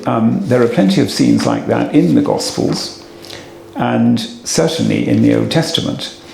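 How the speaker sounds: ordinary delivery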